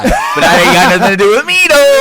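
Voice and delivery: loud, high laughing shout. Real, not acted. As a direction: laugh it out loudly. Laughing at the near fall.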